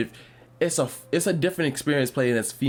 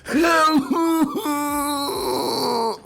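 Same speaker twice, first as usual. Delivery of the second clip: long high laugh, louder at himself; loud high laughter; 3 dB louder than usual. Real, not acted. Laughs after his own shout.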